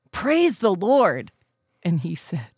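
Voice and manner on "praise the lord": slow, higher mock exclamation; imitating Grandpa. Parent showing child what Grandpa says when hurt, drawn-out exclamation. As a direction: draw out the praise on a raised pitch. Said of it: "when he gets hurt, he says".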